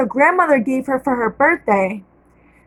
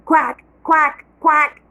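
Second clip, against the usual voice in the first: duck quacks; 2 semitones higher, slower than usual. A narrator's reading. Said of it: "baby ducks saying".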